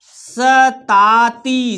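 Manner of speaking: सताती en trois syllabes longues et tenues; détache lentement chaque syllabe de सताती; lecture syllabée. Shown as a demonstration. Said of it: "हमारे साथ पढ़ना है आपको".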